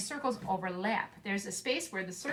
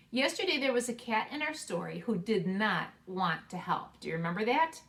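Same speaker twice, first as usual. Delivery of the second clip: ordinary talk, nothing marked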